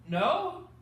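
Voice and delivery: ordinary delivery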